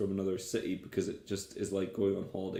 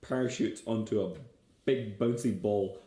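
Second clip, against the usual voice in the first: ordinary delivery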